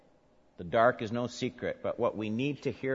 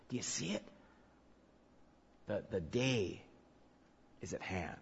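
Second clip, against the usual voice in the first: hushed reading; 2 semitones lower, 10 dB quieter than usual. Acted. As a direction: draw them in quietly, almost to yourself. Hushed opening of the sermon.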